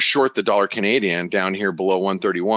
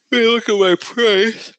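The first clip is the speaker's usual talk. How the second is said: yawning through the words; 6 dB louder, slower than usual. Real, not acted. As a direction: talk mid-yawn, stretched high. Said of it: "I'm sorry, I'm yawning".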